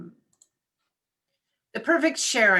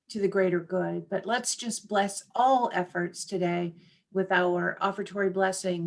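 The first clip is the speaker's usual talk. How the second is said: normal talking voice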